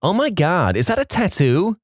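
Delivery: mock the snotty blonde in a Valley Girl voice. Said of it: "asks in her flat Valley Girl voice".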